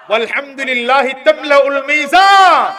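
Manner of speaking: slow, loud Arabic proclamation; Arabic quote boomed to the crowd; declaimed hadith. Acted. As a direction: stretch each word out loudly.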